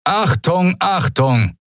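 high-pitched loudspeaker shout; thundering announcement. A narrator's reading. Man over hidden loudspeakers warns everyone, thundering. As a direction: call out sharply like an urgent alarm. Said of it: "donnerte plötzlich die Stimme ... über versteckte Lautsprecher".

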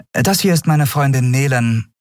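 lightly voiced captain, high; young captain. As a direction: break it to him gently. (Kapitänin, sachlich)

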